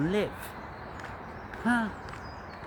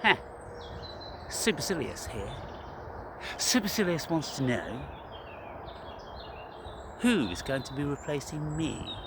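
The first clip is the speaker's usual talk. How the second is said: soft, lower haughty persona voice; Supercilious voice; 4 semitones lower, 9 dB quieter than usual. Retold or imitated. Introducing Supercilious to his therapist, haughty and soft. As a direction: speak as the lofty alter ego. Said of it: "I'm gonna say".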